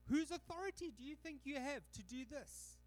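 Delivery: affronted leaders' high mocking demand; high indignant challenge. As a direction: sneer it at him like an offended priest. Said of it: "They're going".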